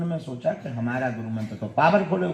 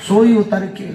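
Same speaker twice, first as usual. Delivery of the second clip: ordinary talk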